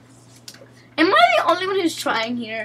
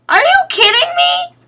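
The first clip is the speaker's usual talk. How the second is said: outraged disbelief; 5 dB louder than usual. Real, not acted. Teammate fails during game.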